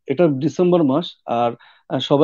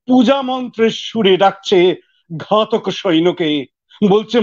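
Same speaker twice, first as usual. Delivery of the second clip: poem recitation; 3 semitones higher than usual. Acted. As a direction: recite with rising dramatic force.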